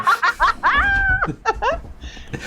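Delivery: high, loud burst of laughter; loud cackling laugh, way up high; big laugh. Real, not acted. Interviewer cracking up at the guest, high loud laugh. Amused at start of exchange.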